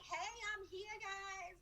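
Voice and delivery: mock bubbly hello to followers; upbeat Instagram hello. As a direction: put on a fake upbeat social media voice. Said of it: "being like".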